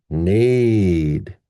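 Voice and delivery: "nade" long, rising then falling; stressed syllable. Shown as a demonstration. Teacher showing the stressed syllable, "nade" long and strong. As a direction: stretch "nade" right out. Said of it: "we have our stressed syllable".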